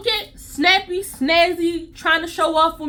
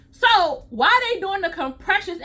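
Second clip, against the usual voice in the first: ordinary talk, nothing marked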